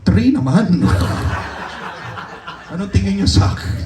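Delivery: laughing at himself through the words; real laughter, self-mocking. Real, not acted. Laughing at his own low score.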